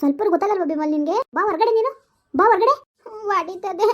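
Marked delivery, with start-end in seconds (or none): 1.4-2.7 calling out. Acted. Aunt calling child out of hiding.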